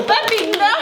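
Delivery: high sharp no; high protest. Real, not acted. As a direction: stop him with a high cry. Stopping the child.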